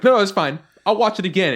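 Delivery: high, loud hurried protest; eager kid protest. Retold or imitated. Nephew insisting to his aunt he can handle it, high and loud. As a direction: insist you're fine, kid-style. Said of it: "He's like".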